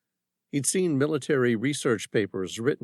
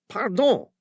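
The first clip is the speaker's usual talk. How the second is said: put-on higher, polite French-accented Pardon; a Frenchman caught off guard; French-accented startled pardon; 8 semitones higher, 4 dB louder than usual. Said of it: "despite his heavy French accent".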